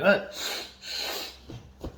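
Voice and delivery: high, stunned outburst; retold shock. Retold shock at ashes being snorted.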